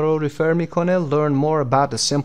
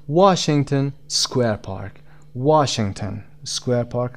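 very slow "Washington Square Park", strong "Wash"; stress on WASH; much slower than usual. Shown as a demonstration. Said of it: "قسمت وا".

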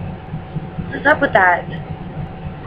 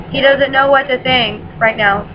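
ordinary delivery

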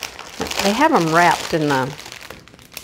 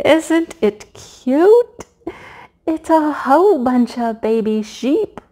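much higher, louder, slow drawn-out cooing; gushing; 6 semitones higher, 3 dB louder, slower than usual. Real, not acted. Gushing to viewers over cute baby sheep, slow coo.

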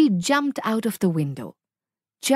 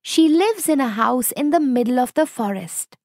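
put-on higher, louder little girl voice; 5 semitones higher, 3 dB louder than usual. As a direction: chirp it out like an eager young girl.

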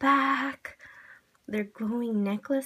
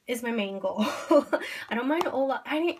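usual speaking voice

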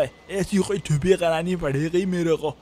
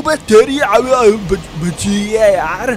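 startled shout; 2 semitones higher, 7 dB louder than usual. Real, not acted. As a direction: yell in shock, then gasp out the near miss. Nearly hit by a train. (चौंककर ज़ोर से चिल्लाना)

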